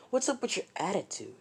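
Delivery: indignant challenge. Retold or imitated. Acted quarrel, challenging back.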